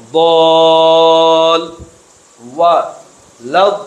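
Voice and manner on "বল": বল stretched into a long sung vowel; long drawn vowel. Shown as a demonstration. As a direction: hold the vowel of বল as a long chant. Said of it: "এভাবে পড়তে হবে".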